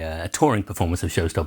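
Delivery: normal talking voice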